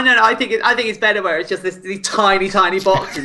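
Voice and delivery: usual speaking voice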